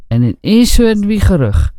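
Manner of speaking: ordinary delivery, nothing marked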